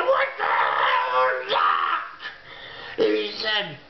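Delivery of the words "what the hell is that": much lower, slow, shouted disbelief; a deep, shouted cry of alarm; shocked shout. Retold or imitated. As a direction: bellow it out in alarm at the spikes. Shocked again at the spikes.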